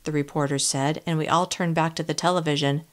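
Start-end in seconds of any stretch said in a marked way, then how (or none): none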